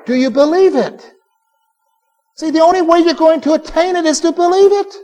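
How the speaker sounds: usual speaking voice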